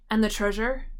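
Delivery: plain reading voice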